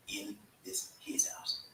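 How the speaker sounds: slow, soft whisper. Acted. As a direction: drop to a hushed whisper. Hushed ending after hype line.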